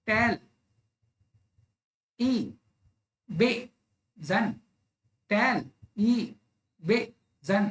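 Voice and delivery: "टेल ई बे जन" as four isolated beats with long pauses; syllable by syllable. Shown as a demonstration. Teacher demonstrating a four-syllable word, syllable by syllable. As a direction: slowly sound out each piece of "टेल ई बे जन". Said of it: "चार syllable वाला कोई example".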